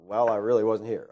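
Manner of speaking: low, quiet, hesitant admission; mumbled excuse, caught out; sheepish Job. Retold or imitated. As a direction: shrink back with a small answer. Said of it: "Yahweh's talking to Job".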